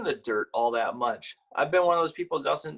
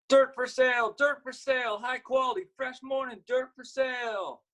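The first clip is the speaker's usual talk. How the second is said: much higher sing-song hawker's call; little girl calling out dirt for sale; calling out wares; 12 semitones higher than usual. Acted. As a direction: cry your wares to passers-by. Girl calls out at her stall.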